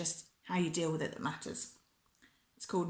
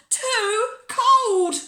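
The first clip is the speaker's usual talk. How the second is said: loud, high, drawn-out wail; tantrum complaint; 12 dB louder than usual. A narrator's reading. Bella objects to her peas at tea, wailing loudly. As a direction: wail both words in protest.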